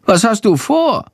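high-pitched little bat voice, asking; high, inquisitive creature; curious bat character. A narrator's reading.

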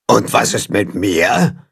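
slow, slightly low creature voice; a hint of a lumbering monster. A narrator's reading. A voice from behind asks to join, slow and slightly low. Unknown shaggy monster speaks up.

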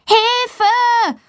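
a high, piercing cry for help; yelling for help. A narrator's reading. Valentina stuck on the door, screaming high for help. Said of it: "ruft Valentina so laut sie kann".

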